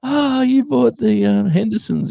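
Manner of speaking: very high, slow gossipy cashier; supermarket lady. Retold or imitated. Cashier realising whose house they bought, high and slow. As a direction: stretch it out like a delighted local gossip. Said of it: "the lady at the supermarket says".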